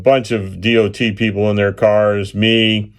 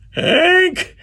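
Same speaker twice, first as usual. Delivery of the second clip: high, worried call down the hill. Retold or imitated. Crew member calling down the dark slope, high and worried. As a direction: call his name into the dark. Someone calls into darkness.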